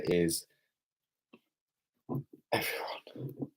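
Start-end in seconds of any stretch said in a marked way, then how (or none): 2.5-3.5 whispered mock-sad whimper; hammed-up forlorn whisper. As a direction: breathe it like a lonely sob. Lonely stream, no one here.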